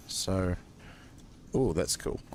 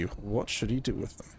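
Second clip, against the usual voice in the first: ordinary talk, nothing marked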